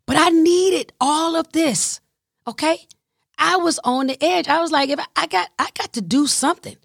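high pitched frantic; near desperate. Real, not acted.

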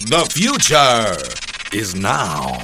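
slow, grand movie trailer announcer; smooth futuristic ad voice. Pretend. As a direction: sell the future like an epic tech ad. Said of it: "You know what you sound like right now?".

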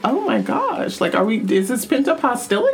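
high, quick mock-alarmed voice; mock shock. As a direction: feign shocked suspicion. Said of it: "I was like".

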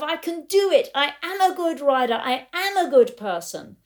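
high, loud self-affirmation; acted-out elation, pitched well up; euphoria. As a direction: declare it elatedly, punching the key words. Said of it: "lose the euphoria of".

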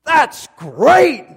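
loud, high shout in a put-on accent; hugely enthusiastic, accented. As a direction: exclaim with over-the-top delight, butchered accent. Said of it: "he's so enthusiastic".

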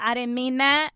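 loud, urgent take-back to the universe; emphatic retraction. Real, not acted. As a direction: insist it out loud. Taking back words to universe.

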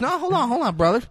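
high, insistent hold-on; urgent pleading. Retold or imitated. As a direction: cut in high, asking for patience. Acting guy urging Denzel to wait.

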